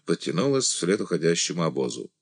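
plain reading voice

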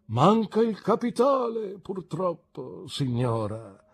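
high lament sinking away; theatrical, sighing woe; sighing regret. A narrator's reading. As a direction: wail it, then let it fade into a sigh. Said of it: "rispose con un sospiro".

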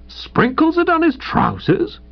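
high-pitched, astonished question; incredulous echo. A narrator's reading. Visitor questions valet about the trousers, incredulous. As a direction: repeat it in rising disbelief.

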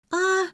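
put-on high-pitched child's yell; child's scream. A narrator's reading. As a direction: scream out in alarm. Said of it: "заорала Лера".

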